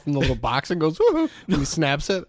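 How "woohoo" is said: high, loud falsetto woohoo; mock Vader cheer. Darth snaps a photo, gleeful falsetto woohoo. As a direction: squeal it out gleefully.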